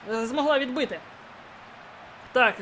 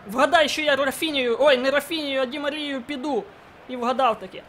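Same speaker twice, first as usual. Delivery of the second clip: ordinary talk, nothing marked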